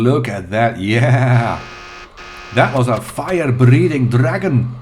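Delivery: loud, slow mock Scottish exclamation; hammed-up, badly faked Scottish accent; unconvincing Scottish accent. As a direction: put on a bad Scottish accent, big and drawn out. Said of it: "very unconvincing Scottish accent".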